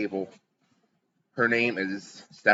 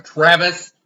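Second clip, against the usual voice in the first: sudden loud, higher yell of a name; calling out; 7 semitones higher, 9 dB louder than usual. Acted. Barmaid yelling at Travis mid-chat, calling out. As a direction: holler across the tavern.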